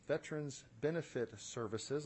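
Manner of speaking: deliberate, measured correction; slowed-down repeat. Real, not acted. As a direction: say it over, slowly and distinctly. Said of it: "I'm talking too fast".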